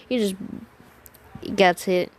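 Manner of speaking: ordinary delivery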